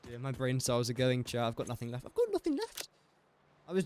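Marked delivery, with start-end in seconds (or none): 2.0-2.9 much higher, put-on cry of despair; high silly voice. To chat about his worn-out brain, high mock wail. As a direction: jump way up in pitch, hammed-up.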